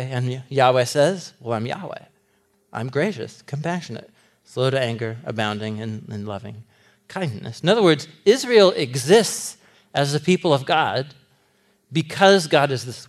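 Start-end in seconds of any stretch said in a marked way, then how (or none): 1.4-7.6 gentle voice of God. Retold or imitated. Preacher voicing God describing himself, soft and tender. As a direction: speak it like a calm self-revelation. God declares his own character.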